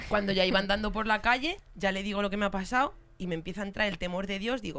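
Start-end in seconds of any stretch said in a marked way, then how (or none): none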